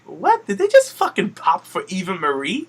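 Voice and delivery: high-pitched, incredulous "what?"; retold disbelief. Host retelling her reaction to the crowd pop, incredulous. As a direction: replay your stunned reaction.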